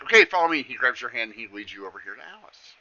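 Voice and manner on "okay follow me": higher, louder brisk summons; eager satyr. Acted. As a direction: beckon them along eagerly. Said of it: "then he's like".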